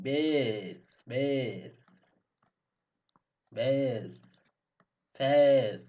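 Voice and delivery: "বেশ, ফেস" with the ey glide held long; drawn-out "ey" vowel. Shown as a demonstration. Teacher showing A as ey, long held vowel in each word. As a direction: stretch each word slowly on its ey. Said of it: "এই দেয়".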